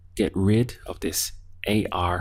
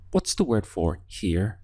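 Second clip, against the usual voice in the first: usual speaking voice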